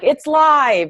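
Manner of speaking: loud, high-pitched mock TV warning; TV live-show alert. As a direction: call it out like a live broadcast alert. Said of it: "like, a T V show's like".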